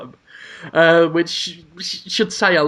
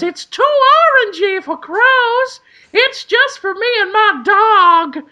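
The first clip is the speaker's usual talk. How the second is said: put-on advert kid voice; 4 dB louder than usual. Retelling the old ad, very high kid voice. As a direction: do the cartoon kid from the ad. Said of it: "and the kid goes".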